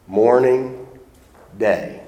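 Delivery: ordinary talk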